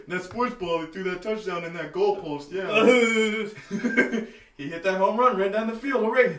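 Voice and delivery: high, loud, laughing fake sports fan; hammed-up clueless sports voice; mock clueless fan. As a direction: play dumb about sports, giggling. Mocking clueless sports talk.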